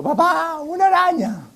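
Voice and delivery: daughter's scream. Retold or imitated. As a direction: squeal it like a scared child. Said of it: "mi hija menor gritó".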